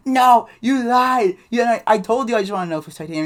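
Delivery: high, loud wail imitating a crying boy; mock tearful outburst. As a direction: bawl the accusation as a kid mid-fit. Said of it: "He's just crying his eyes out".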